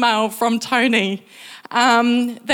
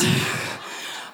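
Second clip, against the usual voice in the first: breathy laugh; 8 dB quieter than usual. Real, not acted. Laugh after telling story.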